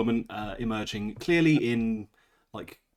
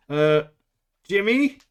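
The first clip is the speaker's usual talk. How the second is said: put-on American accent; 3 semitones higher, 5 dB louder, slower than usual. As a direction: call out in your best American accent.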